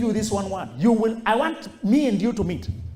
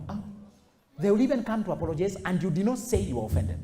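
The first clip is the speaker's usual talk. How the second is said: ordinary talk, nothing marked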